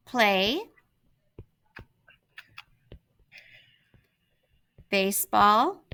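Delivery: one word at a time, long pauses between; slow dictation. Shown as a demonstration. Teacher dictating her topic sentence to students, word by word. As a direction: spell it out slowly for the class while writing. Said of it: "My topic sentence".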